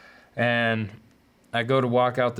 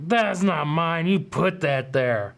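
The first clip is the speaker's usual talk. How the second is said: mock drunken protest, slower at the end; drunk denial; 6 semitones higher, slower than usual. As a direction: play the hammered kid denying it to the cop. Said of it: "I was hammered".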